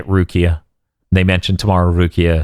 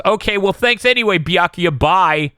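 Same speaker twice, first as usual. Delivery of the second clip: imitating Ichigo; 10 semitones higher than usual. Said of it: "And he goes".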